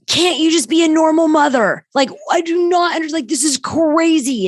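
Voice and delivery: high, exasperated daughter voice. Retold or imitated. Daughter complaining to her mother about crazy calls, high exasperated mimicry. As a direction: mimic a fed-up daughter pleading. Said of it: "my daughter goes".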